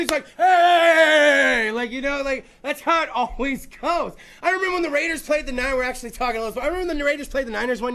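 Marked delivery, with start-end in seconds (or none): 0.4-1.7 loud high bark; mock shout. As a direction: yell it across the bar. Acted bar fight yell.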